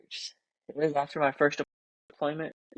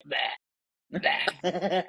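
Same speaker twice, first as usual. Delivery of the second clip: vomiting noises; 3 semitones higher than usual. Retold or imitated. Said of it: "they heard me, like".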